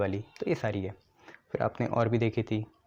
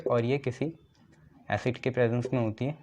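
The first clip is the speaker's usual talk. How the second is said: ordinary talk, nothing marked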